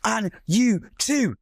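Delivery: a barely-there mild telling-off; soft mum to Gary. Retold or imitated. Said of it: "she'll go to Gary and go".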